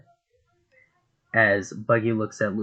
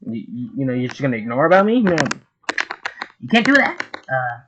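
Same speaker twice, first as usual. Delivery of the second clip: offended clown; 4 semitones higher, 5 dB louder than usual. Acted. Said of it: "As Buggy's like".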